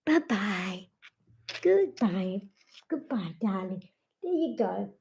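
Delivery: whisper-soft goodbye to a pet. Real, not acted. Owner softly saying goodbye with her dog.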